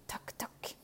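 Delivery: soft whispered knock-knock; knock imitation. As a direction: mouth the knocking softly. Le loup frappe à la porte. (imitation chuchotée du toc toc)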